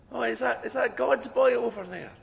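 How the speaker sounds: high-pitched Scottish lad's voice; young Scottish Ned. Acted. Scottish Ned looking across the restaurant, high-voiced. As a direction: pipe up high as a cheeky Scot in a joke. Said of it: "the young Scottish Ned says".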